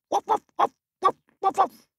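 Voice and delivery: very high little yaps; tiny yapping. Acted. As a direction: yap like a tiny puppy. Small friend dog answers.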